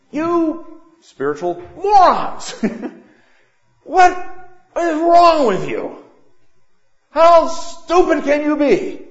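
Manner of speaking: high, loud mock scolding with laughter breaking through; hammed-up divine telling-off, laughing as you yell; scolding God. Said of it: "as he says".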